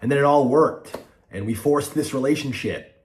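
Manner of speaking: angry. Real, not acted. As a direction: shout it out in frustration. Angry venting about FaZe members.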